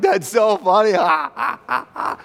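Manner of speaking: high, loud mock crack-up; hammed-up laughter breaking out after the words; acted laughing. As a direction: act out cracking up at a picture. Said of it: "you see it and you laugh".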